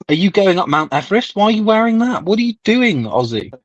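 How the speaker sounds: high-pitched, badgering disbelief; mock-outraged ribbing of a friend; incredulous teasing. Real, not acted. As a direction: pile up the questions, voice climbing high. Teasing friend about his clothes.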